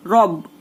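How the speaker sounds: "रब" with a thick, full R; full, heavy R. Shown as a demonstration. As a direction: demonstrate "रब" with a heavy opening R. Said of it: "ये हो गया पुर". ("रब" की र पुर यानी मोटी)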